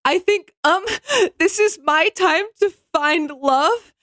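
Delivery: high, wavering mock sob with a laugh; voice cracking. Mocking a crying contestant on her dating show intro, voice cracking. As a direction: imitate a tearful contestant, voice cracking. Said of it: "her voice cracks".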